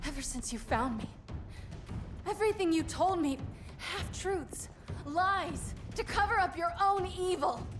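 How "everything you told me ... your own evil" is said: higher-pitched, bitter charge; heated, raised-pitch denunciation; angry accusation. Acted. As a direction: throw the accusation at them. Accuses in a fight.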